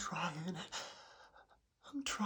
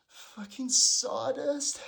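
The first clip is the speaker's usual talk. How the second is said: frustrated; 5 semitones higher, 7 dB louder, slower than usual. Acted. Frustrated after sneezes.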